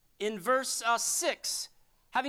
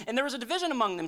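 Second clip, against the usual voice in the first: normal talking voice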